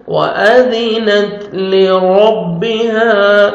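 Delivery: slow chant, "لِرَبِّهَا" with a thick r; heavy raa. Shown as a demonstration. Teacher showing tajweed rule, "لِرَبِّهَا" chanted with heavy raa. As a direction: make the r in "لِرَبِّهَا" full and heavy. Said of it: "را کو موٹا کر کے پڑھیں".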